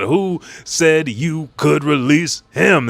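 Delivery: high, loud, slow demand; hammed-up outraged demand; acted angry challenge. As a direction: confront him, stretching the words out. Sanji angrily confronts the enemy.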